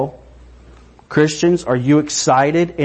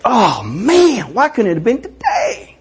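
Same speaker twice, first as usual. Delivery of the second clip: disappointed sigh; 9 semitones higher than usual. Shown as a demonstration. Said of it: "You're like".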